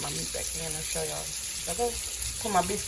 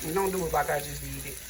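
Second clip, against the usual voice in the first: ordinary delivery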